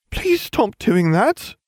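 high, loud, drawn-out begging; pained, high-pitched appeal; distressed plea. A narrator's reading. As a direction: plead slowly with someone to stop. Distressed plea to self-harming elf.

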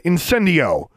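loud, high spell shout. Acted. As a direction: fling the spell out loudly. Casting a fire spell.

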